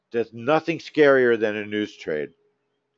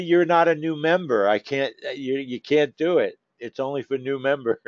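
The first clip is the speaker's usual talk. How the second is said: ordinary delivery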